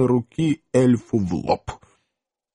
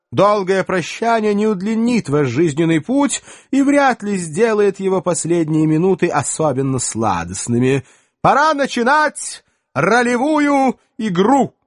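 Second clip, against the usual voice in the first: haughty elf lord; 10 semitones higher, 3 dB louder than usual. Acted. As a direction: announce the hunt with disdain. Elf leader announces the hunt.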